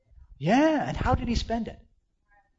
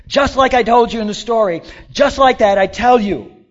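higher, louder emphatic; voicing Jesus; 6 semitones higher, 14 dB louder than usual. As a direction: proclaim with authority. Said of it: "that's what Jesus is saying here".

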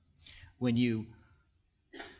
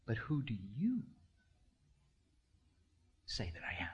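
quiet question; 4 semitones lower, 8 dB quieter than usual. Acted. Quietly reads Jesus's intimate question.

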